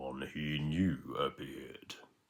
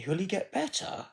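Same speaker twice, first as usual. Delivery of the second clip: much higher worried voice; worried question. Acted. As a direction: ask it high and anxious. Worried miser asks spirit.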